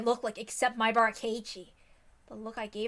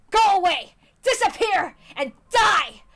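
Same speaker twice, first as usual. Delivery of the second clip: shrieking, much higher and louder; screaming rage; 5 semitones higher, 14 dB louder than usual. Acted. Keiichi screaming death threats in a frenzy. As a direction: scream it out, losing control.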